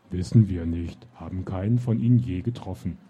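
low, loud, simple giant voice; puzzled giant. Acted. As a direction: answer plainly like a dim giant. Giant answers.

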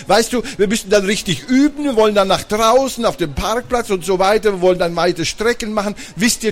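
high-pitched, eager rush of plans; excited boy. A narrator's reading. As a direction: pitch your big plan to the parents. Simon pitches his skateboard plans excitedly.

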